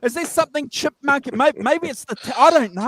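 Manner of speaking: high glitchy call audio; warbling sped-up sound; chipmunk voice. Real, not acted. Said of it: "You're on the helium".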